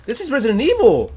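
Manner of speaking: high, loud excited exclamation; excited recognition. Real, not acted. Player recognizing Resident Evil in the game, excited exclamation. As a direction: call it out in amazed delight.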